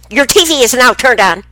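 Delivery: loud, high mimic of a robotic TV voice. Grandmother imitating the new TV's voice, loud high mimic. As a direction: blast it out like the TV announcing itself. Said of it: "she said it so fast".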